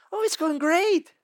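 high, slow and happy; cheerful simple boy. Retold or imitated. Tommy answering a classmate on the bus, slow and cheery. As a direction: beam through the answer.

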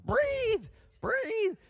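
high-pitched imitation of an urgent order; urgent calling out. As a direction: imitate a nurse calling to rouse a patient. Nurse calling to patient waking up.

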